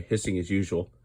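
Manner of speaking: ordinary delivery, nothing marked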